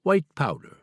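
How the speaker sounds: a hint of a Chinese-accented airport guard; heavy Chinese accent. Airport guard tells the author his target, mild Chinese accent. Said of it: "He answered in his heavy Chinese accent".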